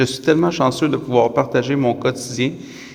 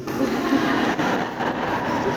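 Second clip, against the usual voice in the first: rire ému; 12 semitones higher, 5 dB quieter than usual. Real, not acted. Pause émue pendant les vœux.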